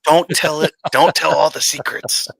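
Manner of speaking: loud mock shushing; playful loud hush. Co-host jokingly guarding the secrets, loud mock hush. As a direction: shush them with exaggerated, forceful volume. Playfully hushing the others, loudly.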